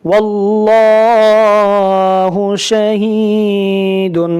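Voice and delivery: slow, drawn-out chanted recitation; slow Quran recitation. Shown as a demonstration. Teacher recites the verse to students, slow melodic chant. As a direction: chant the verse slowly, vowels stretched.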